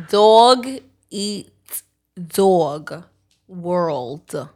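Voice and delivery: Dog, eat, dog, world punched one at a time with pauses; word by word. Shown as a demonstration. Teaching her friend the phrase, word by word in Brooklyn accent. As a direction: spell it out slowly, each word hit hard. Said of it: "I have a little Brooklyn accent".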